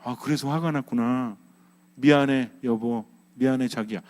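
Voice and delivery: low, soothing make-up voice; calm apology. Acted. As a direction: apologise gently to an angry spouse, pitched low. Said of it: "가만히 계세요". (차분하고 낮은 목소리로 달래는 사과)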